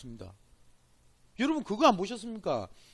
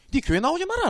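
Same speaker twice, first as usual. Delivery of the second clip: stern pastor; 10 semitones higher than usual. Retold or imitated. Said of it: "안 합니까 저보고".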